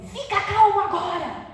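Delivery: put-on a hypocritical parent yelling at a child to calm down; mock yell. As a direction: bark the order to calm down while clearly not calm.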